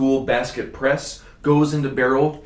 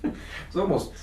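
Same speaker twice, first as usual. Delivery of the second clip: mock Russian accent; 6 semitones higher, 7 dB quieter than usual. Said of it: "is that Russian?".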